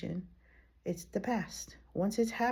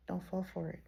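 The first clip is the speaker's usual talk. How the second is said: repeated softly; 10 dB quieter, faster than usual. Shown as a demonstration. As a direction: drop it to a hushed echo.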